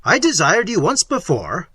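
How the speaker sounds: loud, angry rebuke; Manfred angrily rebuking Isabella. Acted. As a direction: snap the reminder at her. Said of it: "said Manfred angrily".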